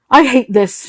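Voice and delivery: announcing. Acted. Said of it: "she announced to the room".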